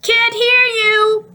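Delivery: very high, slow shout; shouting back. A narrator's reading. Dad shouting back over the TV noise. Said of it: "Dad responded".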